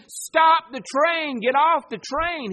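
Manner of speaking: loud, high urgent shout with drawn-out words; screaming warning. Acted. Preacher voices God warning passengers of a doomed train, screaming. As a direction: shout it to halt a disaster. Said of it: "He's screaming".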